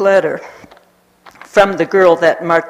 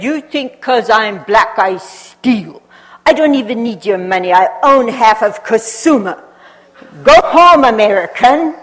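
higher, louder indignant retort; offended tipsy man; 4 semitones higher, 6 dB louder than usual. Retold or imitated. Tipsy man telling the American to go home, indignant. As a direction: scold the accusing foreigner.

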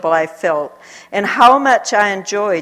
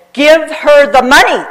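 higher, much louder insistence; firm demand; 8 semitones higher, 10 dB louder than usual. Retold or imitated. Missionary lady insisting to the shopkeeper, loud. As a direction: press the demand harder.

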